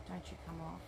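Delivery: usual speaking voice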